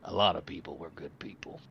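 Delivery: soft, sorrowful whisper, looking down; hushed grief. Acted. Halfling shopkeeper mourns a friend.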